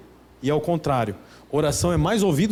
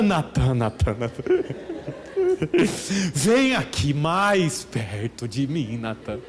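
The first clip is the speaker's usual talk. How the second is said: mock prayer voice to a friend; 6 semitones lower than usual. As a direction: pray at your buddy, mocking it. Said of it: "fala assim".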